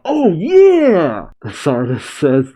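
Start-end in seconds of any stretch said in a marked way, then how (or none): 0.0-1.3 yeah shouted much higher and louder, falling; crying out. Acted. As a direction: whoop it out triumphantly. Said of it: "Or I guess he's crying out".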